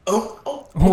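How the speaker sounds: high, drawn-out wolf howl. Shown as a demonstration. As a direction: throw your head back and howl. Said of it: "so you go".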